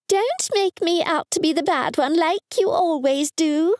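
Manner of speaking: high mum voice calling after her; high complaining mum call out. A narrator's reading. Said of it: "she called after me".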